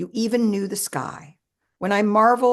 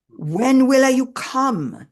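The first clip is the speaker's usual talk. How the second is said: slow, louder Italian-English lilt; Italian-flavoured English, drawn out and raised; quirky Britalian accent; 5 semitones higher, 6 dB louder, much slower than usual. Retold or imitated. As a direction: ask it with an Italian accent, slowly. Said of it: "you asked in your quirky Britalian accent".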